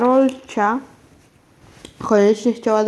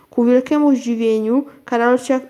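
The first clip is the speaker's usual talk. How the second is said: ordinary delivery, nothing marked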